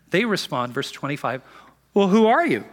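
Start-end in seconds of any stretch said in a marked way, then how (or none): 1.9-2.6 loud, high indignant challenge; demanding. Acted. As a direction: fire the question at him as a demand. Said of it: "they demanded".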